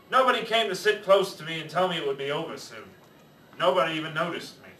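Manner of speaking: ordinary delivery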